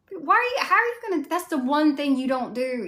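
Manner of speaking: high, fast outraged yell; exasperated outburst. Retold or imitated. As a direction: yell at the screen over the unicorn. Ranting at the film character.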